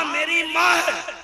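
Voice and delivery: shouted high and slow; indignant shout. Retold or imitated. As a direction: pandit snapping back in offense. Pandit retorts indignantly. (غصے میں چیخ کر)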